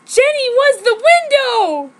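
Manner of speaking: loud, high, slow cliffhanger line; hammed-up shocking reveal; dramatic reveal. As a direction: land the twist big and slow.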